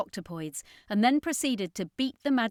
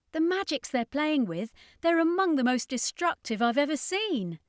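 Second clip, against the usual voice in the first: put-on higher, alarmed young woman; Danica, uneasy; 7 semitones higher, 3 dB louder than usual. A narrator's reading. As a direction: warn of the danger, higher. Danica voiced in story.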